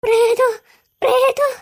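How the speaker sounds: halting, frightened muttered cry; dazed fearful murmuring. Acted. Dazed person murmurs ghost to those nearby, fearful. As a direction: mumble each warning in a daze. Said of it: "ഇവർ എന്തോ പെറുപെറുക്കുന്നുണ്ടല്ലോ".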